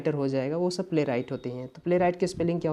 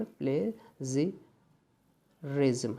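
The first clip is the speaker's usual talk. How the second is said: syllable by syllable; 3 semitones lower, 6 dB quieter, much slower than usual. Shown as a demonstration. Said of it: "मैं फिर लिख देता हूँ".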